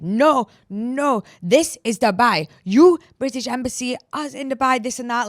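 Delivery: police officer telling off the British Embassy, loud and adamant; imitating officer's loud insistence. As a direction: mimic the officer shouting down the embassy.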